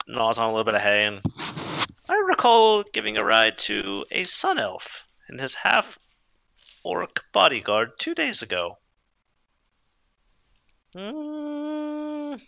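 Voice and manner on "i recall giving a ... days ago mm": slow, drawn-out horse mare; old horse voice. Acted. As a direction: slowly recall as an aging horse. DM voicing Maxine the horse.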